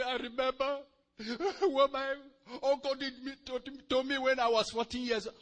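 imitating a tearful man, high-pitched and loud; exaggerated weepy testimony voice; mock crying. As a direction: blubber it out, hammed-up, through fake sobs. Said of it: "he started crying".

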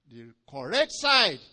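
very loud, slow declaration; emphatic correction. Real, not acted. Preacher correcting the congregation's reading, emphatic declaration. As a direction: drive the point home at full force.

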